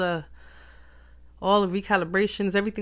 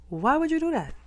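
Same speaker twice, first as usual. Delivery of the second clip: high, louder disbelieving question; exasperated high; 9 semitones higher, 3 dB louder than usual. Shown as a demonstration. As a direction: ask it again, incredulous.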